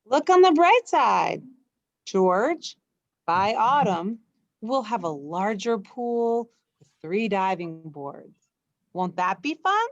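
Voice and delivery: cheerful dad looking on the bright side; cheery reassuring man. Acted. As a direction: sell the good news brightly.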